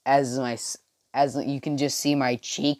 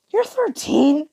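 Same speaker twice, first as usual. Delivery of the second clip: high, louder disbelieving question; astonished squeak of a question; incredulous; 5 dB louder, slower than usual. Acted. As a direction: pitch it way up in disbelief. Acted Deku's disbelieving question.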